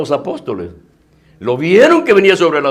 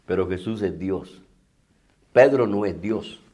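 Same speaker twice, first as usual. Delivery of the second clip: much softer and lower; hushed emphasis; 9 semitones lower, 9 dB quieter than usual. Acted. Preacher to congregation, hushed and low. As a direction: drop the voice to make the point. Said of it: "contrast emphasized".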